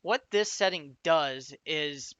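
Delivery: usual speaking voice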